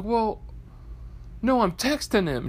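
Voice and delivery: high-pitched, acted-out objection; mock protesting husband. Husband corrects his eavesdropping wife, high mock protest. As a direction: imitate a husband explaining himself.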